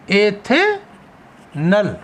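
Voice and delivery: Ethanal drawn out, long pause before the final नल; slow dictation. Shown as a demonstration. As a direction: split ethanal into two slow parts, एथे, then नल. Teacher dictates name in parts.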